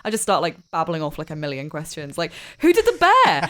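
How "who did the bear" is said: high, loud eager question; bursting with curiosity; excited. Acted. Child quizzing dad about the gift, high and loud. Player gushing over gift arm.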